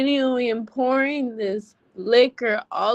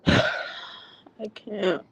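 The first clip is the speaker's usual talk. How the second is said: exhausted sigh; 3 semitones lower, 5 dB quieter than usual. Real, not acted. Overwhelmed, gives up talking.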